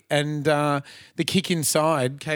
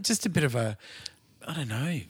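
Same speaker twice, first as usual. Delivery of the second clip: ordinary talk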